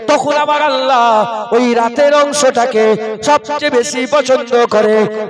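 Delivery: preacher rousing the gathering at a night jalsa, full-force preaching. Acted. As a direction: cry it out to the crowd, building to the climax. Waz preaching, building to climax.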